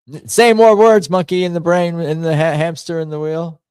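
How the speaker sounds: high, loud self-goading voice; mock command. Streamer goading the monkey in the brain, high mock command. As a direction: playfully order your own brain around, pitched high.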